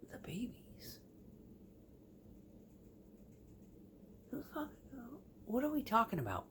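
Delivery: whispered, high and baffled; a hushed, high confused question; incredulous whisper. Retold or imitated. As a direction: breathe it out in disbelief. Retold baffled reaction.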